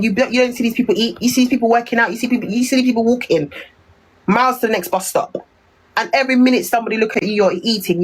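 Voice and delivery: ordinary talk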